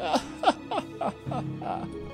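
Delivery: high-pitched villainess cackle; maniacal laugh. Acted. Succubus gloating over her plan, high maniacal laugh. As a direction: cackle madly in falsetto. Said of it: "That maniacal laugh".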